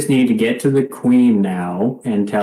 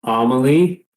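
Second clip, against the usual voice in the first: a mild call through the door; slower than usual. Acted. Knocking on the door and calling for the queen, mild call. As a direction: call her name at the door. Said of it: "calling out Amelie".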